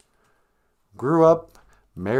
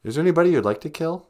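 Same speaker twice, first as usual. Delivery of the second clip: normal talking voice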